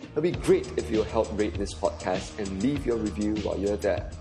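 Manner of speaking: normal talking voice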